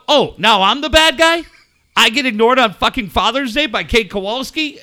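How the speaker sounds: loud, high aggrieved protest; hammed-up outraged complaint; mock indignation. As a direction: play the wronged dad at full volume. Acted quarrel with son.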